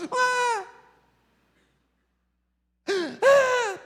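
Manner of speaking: put-on high wailing baby cry; high-pitched imitation of a crying baby; imitated baby crying. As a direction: mimic a bawling child. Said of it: "Você já viu criança quando começa a chorar?".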